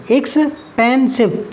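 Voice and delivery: Expensive slowly split x, पेन, सिव; three syllables. Shown as a demonstration. Teacher counting syllables, expensive in three slow beats. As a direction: pause between all three parts of expensive. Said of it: "तीन syllable का है".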